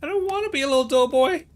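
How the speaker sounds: put-on high, sulky kid refusal; Willy's voice.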